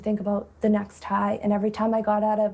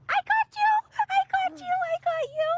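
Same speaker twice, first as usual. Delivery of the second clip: very high squeal of delight after a gasp; playful gotcha. Real, not acted. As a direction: mom catching her son in a game, squealing high. Catching toddler in play.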